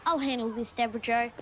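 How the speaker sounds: low, gruff cop voice; tough detective. Acted. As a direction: take over like a hard-boiled cop. Detective takes over scene.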